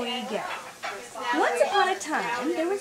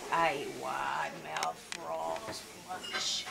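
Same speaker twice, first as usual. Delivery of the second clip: much lower, much softer, slow menacing growl; deep alligator voice; 13 semitones lower, 10 dB quieter, slower than usual. Acted. Grandmother voicing the alligator to the kids, deep and slow. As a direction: drop it low and slow like a lurking alligator.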